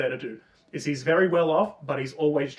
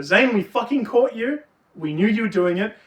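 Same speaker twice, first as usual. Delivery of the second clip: higher, louder gotcha; triumphant shout; 6 semitones higher, 5 dB louder than usual. Retold or imitated. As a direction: catch him red-handed. Kids catch Zane.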